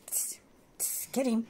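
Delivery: tss sounds then a whispered get him; whispered egging on. Real, not acted. Urging Lucky toward the door, hissed whisper. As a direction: hiss the dog on. Hissing dog on quietly.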